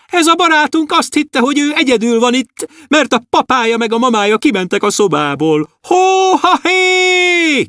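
high dwarf voice calling out; shouting dwarf. Acted. Dwarf shouting to the stars about Andris, high. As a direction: call it up to the stars, high and long. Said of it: "kiabálta".

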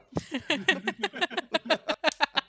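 loud laugh. Real, not acted. Friend laughing at his own Berlin food joke, loud laugh.

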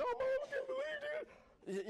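put-on high, slow whining child; whiny kid voice. Preacher voicing a kid whining to mom. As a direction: whine like a kid denied fries.

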